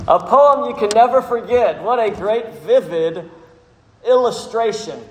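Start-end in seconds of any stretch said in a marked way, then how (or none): none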